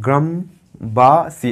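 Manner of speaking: drawn out slowly, syllable by syllable. Shown as a demonstration. Teacher to pupils, slowly splitting গ্রামবাসী into syllables. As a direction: stretch each syllable slowly on the board. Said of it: "এটা তিনটে দল হয়ে গেল".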